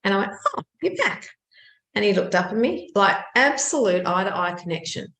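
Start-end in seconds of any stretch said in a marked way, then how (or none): 0.6-1.3 high-pitched, surprised greeting; pleasantly startled, pitched up; delighted surprise. Retold or imitated. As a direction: greet an unexpected visitor in a high voice. Magpie unexpectedly turns up again.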